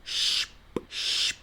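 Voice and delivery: long shh closed by a light p; hush, then pop a p; sonido alemán SP. Shown as a demonstration. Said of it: "S P sería shh". ("shh" largo seguido de una p)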